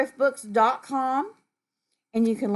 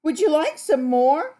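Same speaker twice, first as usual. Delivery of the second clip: high, loud, lilting offer; dinosaur offering more; 6 semitones higher, 6 dB louder than usual. Acted. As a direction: voice a friendly dinosaur offering a snack. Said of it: "She asks you".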